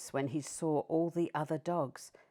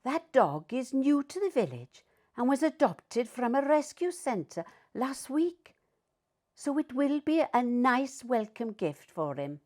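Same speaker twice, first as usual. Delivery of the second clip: higher dog voice; knowing Peppa; 7 semitones higher than usual. A narrator's reading. Peppa telling Alfie about the new dog, higher pitched. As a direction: explain like the one who knows it all. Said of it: "barked Peppa".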